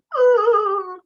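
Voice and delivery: high tearful sigh; crying sigh. Acted. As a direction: let out a sob-like sigh, acted. Acting out happy crying.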